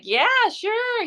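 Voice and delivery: high, bright-eyed imitation of an excited friend; excited yes. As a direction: jump up in pitch, imitating his eager yes. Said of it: "He was excited".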